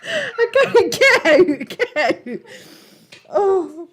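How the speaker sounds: helpless giggling through the words; can barely speak for laughing. Real, not acted. Helpless laughing fit on stream.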